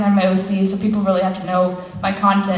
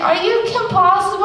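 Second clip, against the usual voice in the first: squeaky eager question, pitched up; high excited fan voice; 11 semitones higher than usual. Retold or imitated. Girl at school recognizing her, high starstruck question.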